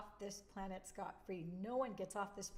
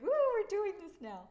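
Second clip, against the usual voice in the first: excited whoop; 12 semitones higher, 12 dB louder than usual. Retold or imitated. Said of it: "It's like".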